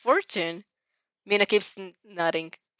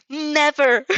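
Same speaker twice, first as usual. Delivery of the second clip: much higher, louder outcry; loud gasped refusal; 7 semitones higher, 7 dB louder than usual. A narrator's reading. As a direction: shout it like a protective mother hen. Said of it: "Kaminari gasps loudly".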